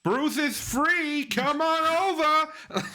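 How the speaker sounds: high, drawn-out Boston holler; Boston accent. Acted. Deli man teasing Ted, mock Boston accent. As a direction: call them over in a broad Boston accent. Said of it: "I don't know why I'm doing that accent".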